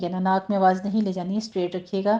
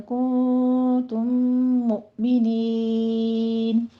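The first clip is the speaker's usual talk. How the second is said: slow melodic tajweed chant; Quran recitation; slower than usual. Shown as a demonstration. Teacher reciting the verse for students, slow chant. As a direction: chant the whole verse slowly and evenly.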